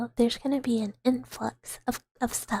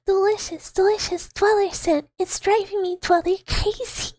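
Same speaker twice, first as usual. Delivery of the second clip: higher and louder, swooning over a smell; mock rapture that keeps climbing; hammed-up ecstasy; 6 semitones higher, 4 dB louder than usual. Streamer gushes over dweller scent, hammed-up rapture.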